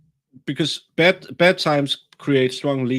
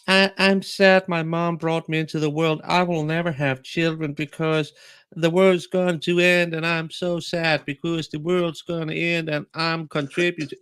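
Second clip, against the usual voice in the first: higher, droning mock lament; mock despair; 4 semitones higher than usual. Mocking people who won't have children, in a droning lament. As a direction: imitate a self-pitying doomsayer.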